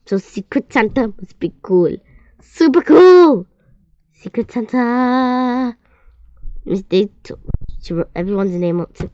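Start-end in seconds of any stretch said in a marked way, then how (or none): 2.4-3.5 high, loud, drawn-out cheer; thrilled shout. A narrator's reading. Kid thrilled about Secret Santa. 4.1-5.8 high, slow, gleeful; excited cheer. A narrator's reading. Kid thrilled about Secret Santa.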